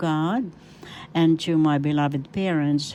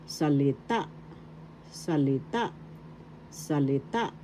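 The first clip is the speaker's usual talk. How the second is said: salita as sali-TA, final TA lifted; stress on third syllable; 5 dB quieter than usual. Shown as a demonstration. Teacher drilling word in Tagalog, salita stressed on TA. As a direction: lift the last syllable of salita. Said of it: "The accent is in the third syllable".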